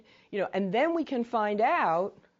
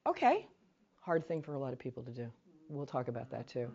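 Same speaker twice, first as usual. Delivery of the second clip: normal talking voice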